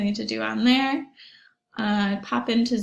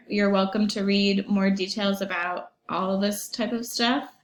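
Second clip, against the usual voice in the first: normal talking voice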